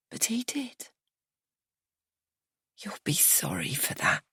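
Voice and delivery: unvoiced threat; menacing whisper. A narrator's reading.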